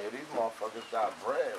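ordinary talk

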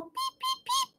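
tiny high-pitched peeps; very high soft peeping; high chick peeps. Acted. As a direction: squeak like a baby chick. Chick sound for kids.

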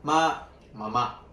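"ma, mamá" drawn out slowly with Mandarin tones; slow tone example. Shown as a demonstration. Showing the host a tone example, slow and careful. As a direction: stretch "ma, mamá" so each tone is audible. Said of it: "Por ejemplo".

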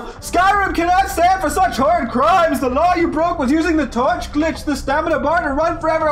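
bang the gavel and pronounce judgment from the pulpit; pompous church judge. Retold or imitated. Reading the Skyrim ruling aloud as a gavel-banging priest. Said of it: "like, priest or, like, church dude, like, banging the gavel".

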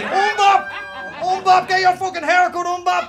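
high, loud jeering yell; mocking shout. Schoolkids jeering at his long hair, loud mock shout. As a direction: yell the nickname across the street. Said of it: "People just shouted at me".

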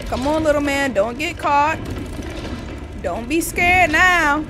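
high, loud encouragement; urgent cheering on. Real, not acted. Player urging little man to escape, loud and urgent. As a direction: push him on through the danger. Urging character through chase.

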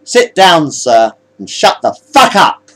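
loud, slowing put-down; angry order. Acted. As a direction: shut the captain up forcefully. First officer threatens the captain.

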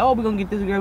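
usual speaking voice

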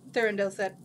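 plain reading voice

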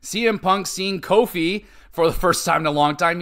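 ordinary talk

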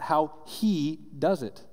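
slow stress on how he does it; slowed down to underline does; emphasized. Shown as a demonstration. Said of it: "I wanna emphasize that".

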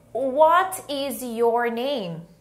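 very slow, word by word; slow model question. Shown as a demonstration. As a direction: stretch each word for learners to follow. (pergunta bem devagar, palavra por palavra)

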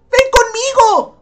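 high, loud, drawn-out call; urgent invitation. Acted. Departing boy asks Rachel along, calling out. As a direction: call out slowly and loudly.

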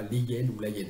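"les hyènes ou la hyène" as "lé yène", "la yène", no z; incorrect hiatus. Shown as a demonstration. Translator showing the form to avoid, no liaison. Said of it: "et non pas".

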